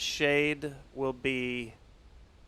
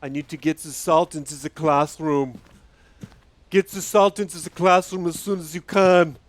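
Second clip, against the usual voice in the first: put-on Schwarzenegger Austrian accent; 8 dB louder than usual. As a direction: bark orders like a movie Austrian strongman. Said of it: "This is my Schwarzenegger accent".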